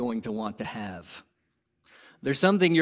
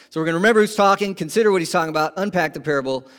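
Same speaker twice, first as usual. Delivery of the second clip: fast, loud run-through of the points; quick recap; 3 semitones higher, 7 dB louder, much faster than usual. Shown as a demonstration. Pastor recapping his sermon points, fast and loud. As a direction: rattle the outline off quickly. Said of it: "recap of the four points".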